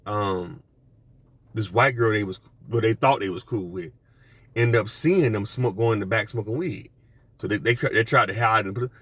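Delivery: ordinary talk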